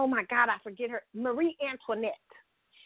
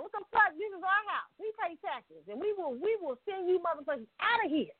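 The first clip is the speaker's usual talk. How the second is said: raised, indignant crowd voice at Congress; mock protester outburst; 7 semitones higher than usual. Protester yelling at Congress, mock angry outburst. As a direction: shout it like a protester storming in.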